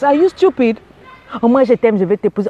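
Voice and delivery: normal talking voice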